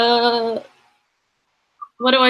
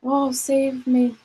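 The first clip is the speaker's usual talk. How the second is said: slow, higher damsel in distress plea; fluttering cries for help; 4 semitones higher, slower than usual. Acted. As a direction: swoon out a helpless cry for rescue. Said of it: "pretend to be a damsel in distress".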